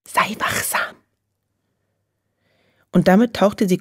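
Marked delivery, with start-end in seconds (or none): 0.1-0.9 hushed, breathy warning; whispered. A narrator's reading. Nelly whispering to Trude before diving into the dark. Sneaking out at night.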